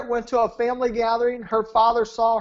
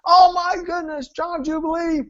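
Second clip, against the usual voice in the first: high-pitched, thrilled exclamation; bouncing excitement; 7 semitones higher than usual. Retold or imitated. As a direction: burst in, imitating an overjoyed client. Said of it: "he comes bouncing in".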